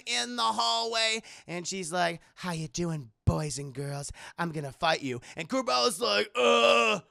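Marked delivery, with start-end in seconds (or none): 2.3-5.2 lightly voiced low villainess; villainess taunt. Villainess greets her opponents. 6.4-7.0 loud mock groan of reluctance; reluctant groan. Reluctant fighter groans before refusing.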